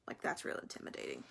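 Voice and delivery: very low, near-whispered sarcasm; mock the bully under your breath; dry sarcastic murmur; sarcastic aside. Real, not acted.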